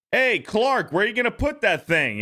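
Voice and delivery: high, mock-snooty neighbor voice; quoted neighbor. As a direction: play the uptight yuppie neighbor, pitched high. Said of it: "And he's like".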